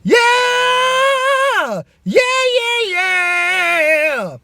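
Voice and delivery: high screamed yeahs; chest scream. Shown as a demonstration. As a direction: belt harsh screamed yeahs. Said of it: "trap it down in the chest voice".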